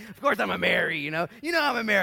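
high, loud exasperated wife. Retold or imitated. Pastor voices his wife telling him she's obviously a Mary. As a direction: play it like it's obvious to the wife. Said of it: "You know, like".

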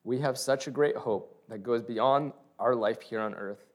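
ordinary talk, nothing marked

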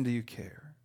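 breathless hushed plea; whisper. Retold or imitated. Preacher whispering a desperate question to God. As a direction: let the question die to a whisper.

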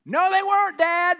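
high, loud exclamation; loud rebuttal to the father; shouted retort. Acted. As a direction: fire back at dad, loud and high. Preacher retorts to the dad.

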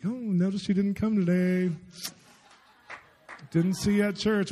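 high, slow, falsely sweet chiding; mock busybody drawing out the words; church police. Church police checking on someone who missed church, high drawn-out prying. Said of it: "there's no church police who's like".

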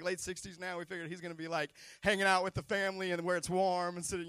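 usual speaking voice

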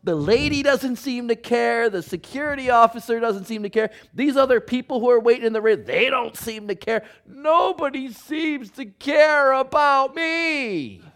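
high, loud whiny complaint, slowing at the end; self-pity whine. Retold or imitated. Husband stuck in ER, whining high and loud. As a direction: whine like nobody cares about you. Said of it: "I remember thinking to myself".

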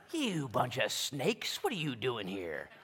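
slow, scornful jab at the crowd; mock him chewing them out; mock harsh prophet. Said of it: "this isn't the best way to start your sermons".

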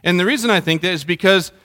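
ordinary talk

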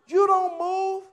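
loud emphatic preaching punch; preacher's emphatic declaration. Real, not acted. Preacher stresses kingly stillness.

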